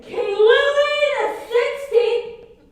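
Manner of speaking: high, loud, slowed announcement; dramatic proclamation. Acted. Student naming the hated royals in a loud, slow, high voice. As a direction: declaim the royal names grandly.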